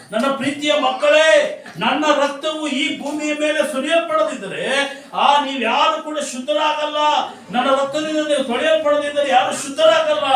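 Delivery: preacher voicing Jesus, loud and high. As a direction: cry it out to his beloved children. Preacher voices Jesus declaring.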